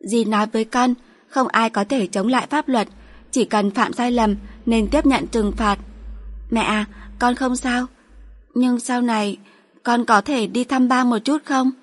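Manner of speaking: put-on a little girl bravely comforting her mom; young daughter.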